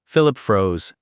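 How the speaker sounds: ordinary delivery, nothing marked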